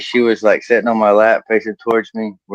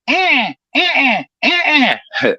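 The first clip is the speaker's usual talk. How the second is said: high-pitched dolphin chatter, in short bursts; dolphin squeal; slower than usual. Acted. Comic punchline of a woman looking back, high dolphin squeals. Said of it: "doing a dolphin style is".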